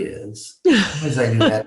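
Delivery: chuckling through the words; amused, half-laughing quote. Retold or imitated. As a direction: laugh while retelling it. Retelling the medium's amused quote.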